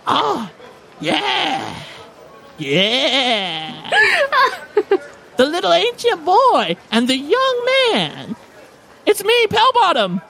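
high, drawn-out gnome crone voice; exuberant old gnome woman. Acted. Old gnome woman greeting returning adventurers, exuberant and high.

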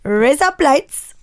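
Indian accent. Shown as a demonstration. Repeating the hack for laughs, Indian accent. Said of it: "much better in an Indian accent".

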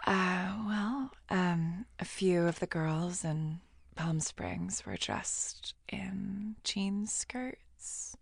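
slow creepy whisper; crazy voice. Shown as a demonstration. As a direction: whisper it like an unhinged villain. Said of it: "Wait, no, let me do it".

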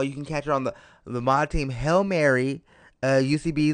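usual speaking voice

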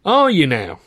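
high, loud, exasperated boss; impatient manager. Acted. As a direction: hurry him along, very impatient. Manager skeptical.

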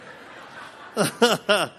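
high burst of laughter; real laugh. Real, not acted. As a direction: laugh at being recognised. Laughs after being recognised.

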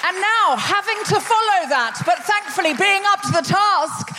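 high, excited compère intro; announcer. Real, not acted. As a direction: build it up to the big name. Host introduces next act.

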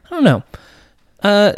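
ordinary talk, nothing marked

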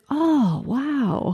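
high "oh" dropping to a soft "wow"; imitated amazement. Imitates his amazement at phone camera.

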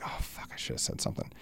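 breathy whispered panic, voice gone; panicked whisper. Retold or imitated. Stuck in the elevator with the new kid, whispered panic. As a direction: whisper it to yourself as the dread hits.

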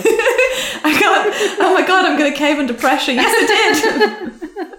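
giggling through the words; laughing. Real, not acted. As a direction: confess while cracking up. Guest caves, laughing hard.

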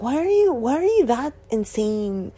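high-pitched incredulous mock question; mocking disbelief. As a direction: squeal it like a scornful critic. Voicing people who mock devotees.

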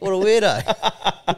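slow, high laughing remark; laughing slowly. Real, not acted. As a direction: laugh the words out.

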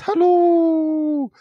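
a sudden very high, drawn-out Hello; high mock greeting. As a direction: sing out Hello in a high stretched voice. Reading out a listener's greeting.

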